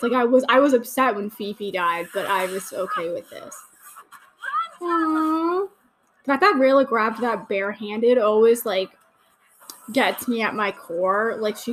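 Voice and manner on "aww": high, drawn-out aww; touched aww at a cute moment. Real, not acted. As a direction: coo at something adorable.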